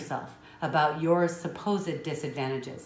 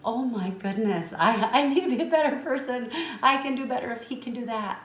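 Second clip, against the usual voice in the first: much higher and louder, overwhelmed with emotion; moved outburst; 9 semitones higher, 6 dB louder than usual. Real, not acted. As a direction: burst out as if deeply stirred.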